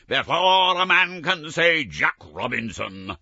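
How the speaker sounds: loud, high, hearty call; a jolly master rallying his boys; jovial Fezziwig. Acted. Fezziwig to his apprentices, loud and jovial. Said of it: "cried old Fezziwig".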